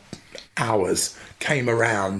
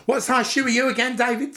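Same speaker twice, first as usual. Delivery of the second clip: much higher, motherly mock voice; imitating mum; 13 semitones higher than usual. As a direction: squeak it up like his mother asking. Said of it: "she'd say".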